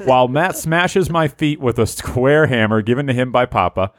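ordinary talk

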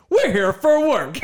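hammed-up punchline impression, slow and high; mocking a comedian's delivery; Peyton. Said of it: "He really dials it up".

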